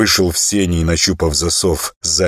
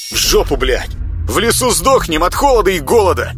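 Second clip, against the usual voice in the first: loud, high exasperated yelling; angry outburst; 12 semitones higher, 4 dB louder than usual. Acted. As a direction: shout it out in frustration. Ряба swears, fed up. (злой выкрик)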